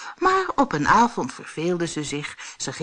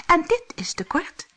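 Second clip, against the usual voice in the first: put-on high-pitched little storybook girl; small, high character voice; 13 semitones higher than usual. A narrator's reading.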